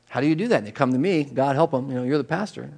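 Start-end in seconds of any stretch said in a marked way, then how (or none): none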